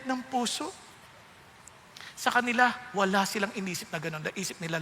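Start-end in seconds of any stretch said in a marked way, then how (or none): none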